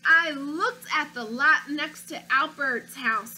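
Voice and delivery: put-on storybook read-aloud, childlike narrator; reading to children. Teacher reading a picture book to her class, storytelling voice. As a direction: read it like a stubborn little boy.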